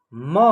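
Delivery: म sounded alone with its full inherent vowel; single-letter sounding. Shown as a demonstration. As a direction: sound out म as a separate syllable. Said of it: "एक एक अक्षर का उच्चारण करेंगे".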